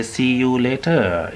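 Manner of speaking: SEE YOU LATER with each word separate; anxious, over-careful wording. Shown as a demonstration. Teacher showing how anxious speakers say it, careful separate words. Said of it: "it means that you are anxious".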